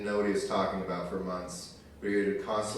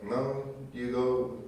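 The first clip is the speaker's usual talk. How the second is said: put-on Mexican accent; 3 semitones higher than usual. Mexicans politely turning down slaves, mock Mexican accent. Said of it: "I'll do that accent all night".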